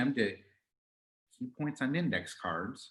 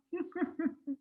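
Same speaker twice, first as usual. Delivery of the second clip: smug laugh; 4 dB quieter than usual. Acted. Monday laughing after taunt.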